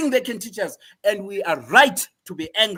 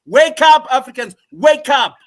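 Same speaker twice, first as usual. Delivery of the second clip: loud, higher cry to wake people up; rallying shout; 4 semitones higher, 10 dB louder than usual. Real, not acted. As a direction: shout it like a call to arms. Rallying call to listeners.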